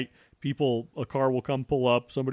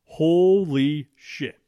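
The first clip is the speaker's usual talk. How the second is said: slow, drawn-out awe; stunned; 5 semitones higher, 7 dB louder, much slower than usual. Real, not acted. Telling of finding the meth lab, slow stunned awe. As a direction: let it sink in slowly. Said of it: "I was beside myself".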